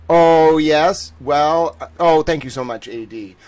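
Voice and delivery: ordinary talk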